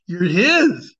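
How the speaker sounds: slow, emphatic punch on HIS. Real, not acted.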